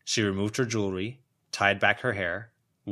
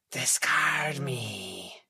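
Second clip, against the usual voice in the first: a slow, voiceless, otherworldly whisper; whispering mummy; 3 semitones higher, much slower than usual. Acted. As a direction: breathe it out like a dead thing from its wrappings. Mummy speaks for the first time.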